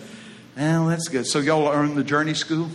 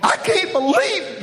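mock disbelief; slower than usual. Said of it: "people go".

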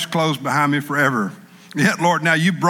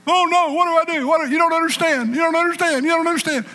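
much higher, pleading "you don't understand"; a desperate believer refusing to listen; high-pitched mock wail; whining congregant; faster than usual. Congregant whining, voiced by preacher.

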